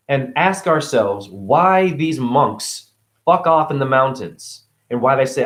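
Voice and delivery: normal talking voice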